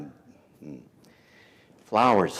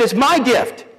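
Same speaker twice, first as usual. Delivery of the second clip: much higher, louder mock wife; wife's quote; 12 semitones higher, 6 dB louder than usual. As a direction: chirp it high and loud like the wife. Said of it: "She said".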